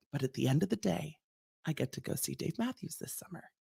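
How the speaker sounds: a small defeated sigh of a voice; resigned consumer. Retold or imitated. Imitating fans who accept the fees, resigned and hushed. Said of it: "They count on you being like".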